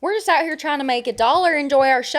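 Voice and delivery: high, twangy lament; country accent. Pretend. Siding with co-host against celebrities, country accent. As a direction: join in with a Southern twang. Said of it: "I can do a country accent".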